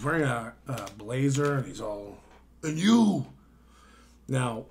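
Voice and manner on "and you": high, loud drunken imitation; hammed-up drunk man, raised voice; mock drunk. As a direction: blurt it like a belligerent drunk. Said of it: "you can tell he's very drunk".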